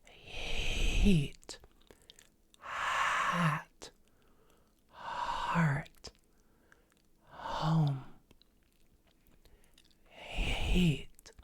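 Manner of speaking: breathy whispered words with long H; extended breathy H. Shown as a demonstration. Coach demonstrating drawn-out breathy H. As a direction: stretch the breathy H at the front of each word. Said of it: "So it's gonna sound like this".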